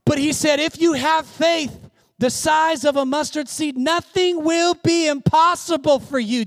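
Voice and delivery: high, ringing, emphatic sermon delivery; preaching at full force. Real, not acted. Preacher urging faith on struggling listeners, impassioned. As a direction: drive each phrase out to the congregation. Preaches the mustard seed promise.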